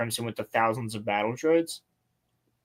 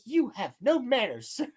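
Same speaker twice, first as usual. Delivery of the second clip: imitated scolding; 10 semitones higher, 3 dB quieter than usual. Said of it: "he's scolding Grievous in his face".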